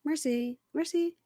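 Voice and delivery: high, soft Merci, merci; soft French merci. Shown as a demonstration. Demonstrating her own shop French, soft high Merci. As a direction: breathe Merci, merci lightly up high. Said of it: "How was she saying it?".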